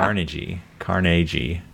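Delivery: Carnegie with the G said soft, like J. Shown as a demonstration. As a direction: try Carnegie with a soft J sound. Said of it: "As a soft G".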